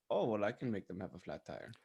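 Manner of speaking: ordinary delivery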